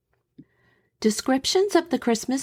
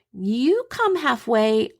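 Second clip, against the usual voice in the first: slow, higher call across the field; slow raised call, a touch of a shout; 5 semitones higher, slower than usual. Retold or imitated. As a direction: stretch it out like calling to the enemy trench. Sergeant calling across no man's land.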